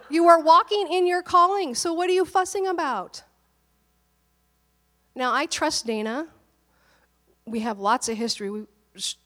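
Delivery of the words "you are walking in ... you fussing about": higher and louder, fed-up telling-off; exasperated friend's scolding. Retold or imitated. Friend reminding him he is in his calling, exasperated and raised.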